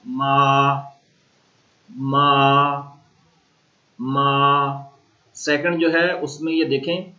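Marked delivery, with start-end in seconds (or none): 0.1-0.9 मा held level and steady; first tone ma. Shown as a demonstration. Teacher demonstrating a flat first tone. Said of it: "तो उसका sound होगा मा". 1.9-4.9 मा held level and flat; first tone ma. Shown as a demonstration. Teacher repeating a level first-tone मा. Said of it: "first tone है, तो उसका sound होगा मा".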